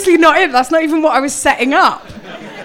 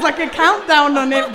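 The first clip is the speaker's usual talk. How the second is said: ordinary delivery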